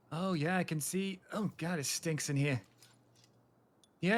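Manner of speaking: ordinary delivery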